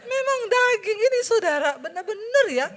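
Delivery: high, loud, laughing; excited exclamation. Real, not acted. Preacher to congregation, loud laughing exclamation. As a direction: cry it out still laughing.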